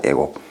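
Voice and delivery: 애곡 said low, without the w glide; Jeolla pronunciation. Shown as a demonstration. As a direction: demonstrate dialect 애곡 for "waegok". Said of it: "왜곡이라는 발음을 좀 잘 못하죠".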